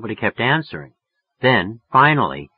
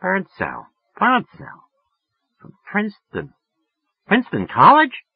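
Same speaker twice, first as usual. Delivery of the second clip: much higher, musing woman's voice; thinking it over; 10 semitones higher than usual. A narrator's reading. Woman musing over a name she half knows, much higher. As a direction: turn the name over aloud. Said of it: "running the name over in her mind".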